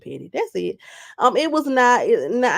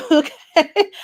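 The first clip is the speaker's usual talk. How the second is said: laughing; 7 semitones higher, 4 dB louder than usual. Real, not acted. Laughs on the word.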